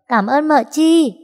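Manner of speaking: put-on high, loud and slow child's thanks; a gap-toothed grin in the voice; beaming little boy. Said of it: "cười toe". (bé trai cười toe cảm ơn, cao và chậm)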